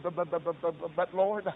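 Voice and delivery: imitated rapid-fire stammer; mock stuttering Moses. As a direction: stammer on the first word before getting it out. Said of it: "got all excited and started stuttering".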